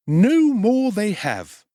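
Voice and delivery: high, slow, fawning undertaker voice; high ingratiating tradesman; oily undertaker. Acted. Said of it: "acquiesced the undertaker".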